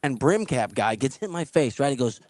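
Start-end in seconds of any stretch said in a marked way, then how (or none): none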